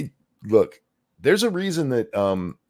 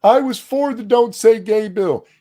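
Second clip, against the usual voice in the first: mocking imitation; 9 semitones higher, 7 dB louder than usual. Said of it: "And you're like".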